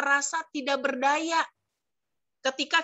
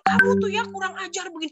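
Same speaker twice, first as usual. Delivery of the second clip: higher, louder, fast furious snap; angry scolding; 8 semitones higher, 4 dB louder, faster than usual. Acted. Annoyed person scolding someone rudely, high fast anger. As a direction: scold someone who has pushed you too far. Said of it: "marah, jadi ngomongnya".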